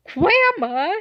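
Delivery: high, loud questioning call; incredulous question. Retold or imitated. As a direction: ask it in stunned disbelief, voice shooting up. Recognises grandma as the intruder.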